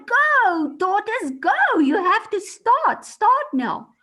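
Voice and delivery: loud, high urging shouts; crowd shouting. A narrator's reading. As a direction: shout at him to get going. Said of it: "everyone shouted at him and said".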